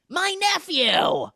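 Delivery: high, loud, drawn-out shout; hammed-up piercing holler; mock scream. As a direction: scream it out slowly like an interrupting old man. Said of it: "appears and screams".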